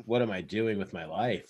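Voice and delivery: low, deflated self-questioning; regretful. Acted. Shopkeeper questioning her choices mid-tryst, low and regretful. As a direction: drop the pitch into weary regret.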